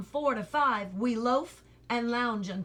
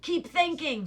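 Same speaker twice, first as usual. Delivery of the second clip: higher, stern rebuke; scolding; 7 semitones higher, 3 dB louder than usual. A narrator's reading. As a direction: scold the boy sharply. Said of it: "scolded the watchdog".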